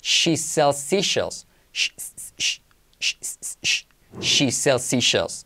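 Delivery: very slow, each "sh" and "s" drawn out; "She sells seashells" word by word; slow tongue twister. Shown as a demonstration. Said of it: "Hasta que lo tengas". (trabalenguas muy lento, marcando "sh")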